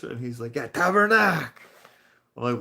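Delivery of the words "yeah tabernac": high, loud mock French-accented curse. Coworker confirms the church word, imitated French accent. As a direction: exclaim it like the French-speaking coworker. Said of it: "guy who would say it".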